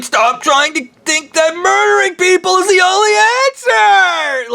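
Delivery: high scolding mock voice; scolding god. Mocking a god who scolds him, high voice. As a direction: scold like a shrill parent. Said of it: "Anal Eating Mummy God was like".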